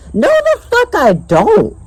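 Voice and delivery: high, drawn-out outraged protest; indignant denial. Real, not acted. Vlogger denying her claim he likes her, indignant denial. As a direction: squeal the denial in disbelief. Angrily denies what she claimed.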